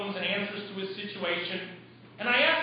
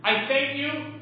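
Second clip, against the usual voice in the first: impassioned prayer; 4 semitones higher than usual. Acted. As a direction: preach the prayer at full force. Preacher voicing surrendering prayer.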